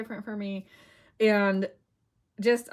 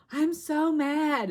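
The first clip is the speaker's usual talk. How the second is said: slow, much higher and louder mock fury; exaggerated mad; 8 semitones higher, 5 dB louder, much slower than usual. Telling Mark about the ruined pages, mock furious and slow. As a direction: drag out the complaint in a hammed-up rage. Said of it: "I was like".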